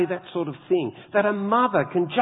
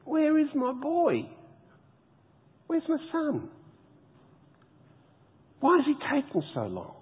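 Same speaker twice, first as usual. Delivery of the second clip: a mother's high anxious wondering, fading softer; worried mother; 8 semitones higher, 4 dB quieter than usual. Acted. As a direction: ask it like a mother waiting for her son. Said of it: "She's wondering".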